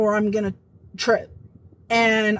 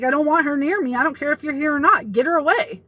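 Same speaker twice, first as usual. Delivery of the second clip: higher-pitched, insistent objection; retold urgent protest; 4 semitones higher, faster than usual. Telling HR to keep the coworker away, insistent. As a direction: re-enact pushing back, mildly alarmed.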